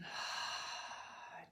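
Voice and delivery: long, breathy exhale; big letting-go sigh. Shown as a demonstration. Teacher showing students how to settle in, big sigh. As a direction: take a big, audible sigh out. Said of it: "take a nice big ha or ah".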